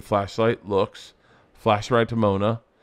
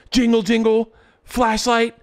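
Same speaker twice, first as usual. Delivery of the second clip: excited play-by-play; 11 semitones higher, 4 dB louder than usual. Retold or imitated. Acting out the footage.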